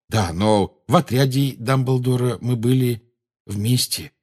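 ordinary delivery, nothing marked

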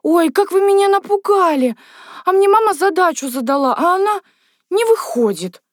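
high-pitched tearful wail; bursting into tears. A narrator's reading. Lyusya complains about her mother's sum, wailing tearfully. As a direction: wail out the complaint like an upset child. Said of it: "Люся громко расплакалась".

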